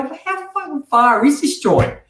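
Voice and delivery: tired traveller mocking his own long drive, exasperated; exasperated outburst. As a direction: drag it out like a road-weary driver losing patience.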